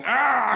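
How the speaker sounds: short high yelled "Ah!"; a grimacing face screaming out loud; mock scream. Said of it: "a man's face on your stomach going".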